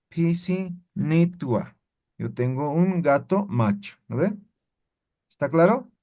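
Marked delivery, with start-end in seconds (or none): 0.1-1.7 pisinitua drawn out slowly, syllable by syllable; slow model word. Shown as a demonstration. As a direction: spell out pisinitua slowly for the class. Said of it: "Entonces yo digo".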